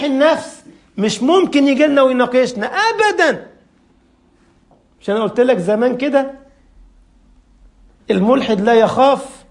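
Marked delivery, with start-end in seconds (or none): 2.7-3.5 أبدًا pushed much higher; emphatic never. Real, not acted. A preacher dismissing the atheist, أبدًا thrown high. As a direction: fling أبدًا up high to drive the point home.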